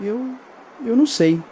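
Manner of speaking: flustered and scared; panicked stammer. Acted. (esposa em pânico, voz bem aguda)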